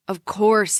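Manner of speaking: ordinary delivery, nothing marked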